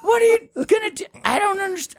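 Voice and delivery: very high, squeaky mock bewilderment; confused friend. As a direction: play the baffled friend in a squeaky voice. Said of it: "And you're like".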